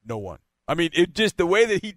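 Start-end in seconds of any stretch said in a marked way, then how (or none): 0.1-0.4 low, quiet deadpan reply; deadpan, much lower and softer; dead serious. Retold or imitated. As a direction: imitate a player turning suddenly serious, dropped low and soft. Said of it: "like, all serious".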